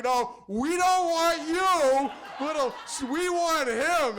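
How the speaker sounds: high, very drawn-out crowd cry; mock insistent demand. Old women rejecting the other server, loudly insistent. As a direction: wail it as adoring fans.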